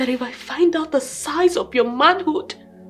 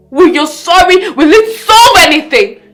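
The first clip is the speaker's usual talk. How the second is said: loud, emphatic question hitting "solve"; angry challenge; 6 dB louder than usual. Acted. Wife challenging her husband's useless apology, loudly angry. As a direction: throw the question at him forcefully.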